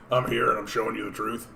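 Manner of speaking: lightly voiced conspiracy-radio ranter; a hint of a paranoid talk-show host; half-hearted mock broadcaster proclaiming truth; Alex Jones impression. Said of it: "can't even do my Alex Jones impression".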